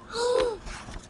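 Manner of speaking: sharp frightened whispered gasp. A narrator's reading. Reader acts fright for child, whispered gasp.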